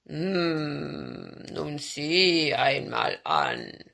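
low, very slow bear rumble; lumbering, humming bear; growling bear. A narrator's reading. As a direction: drag it out in a deep bear voice. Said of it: "brummte er".

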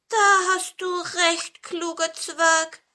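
high, drawn-out agreement; plaintive pine cone. A narrator's reading. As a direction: agree ruefully in a little creature's voice. Cone sadly agrees with dwarf. (hohe, klagende Zapfenstimme)

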